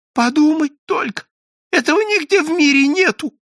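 high-pitched, anxious gossiping voice; timid chatterer. A narrator's reading. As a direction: pester them nervously in a high little voice. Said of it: "боязливый Иоанна Польский, беспрерывно шепча".

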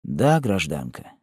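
low, slow, understated emotion; moved voice. A narrator's reading. Executioner answering the condemned woman, in a moved voice. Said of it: "отвечал он взволнованным голосом".

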